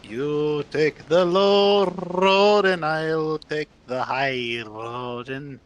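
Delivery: put-on slow, full Scottish accent, R's rolled hard; Scottish accent played up, slow, rolled R's like Sean Connery. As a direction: lean fully into the Scottish accent and roll every R. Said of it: "They roll their R's".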